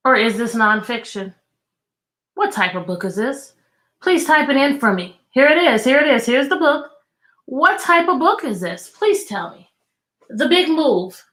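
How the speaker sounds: ordinary delivery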